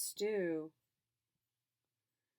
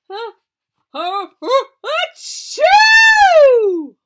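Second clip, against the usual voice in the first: building huh huh to a loud, high achoo; big sneeze; 22 dB louder, much slower than usual. Acted. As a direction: wind up and explode into a sneeze. Bear sneeze built up.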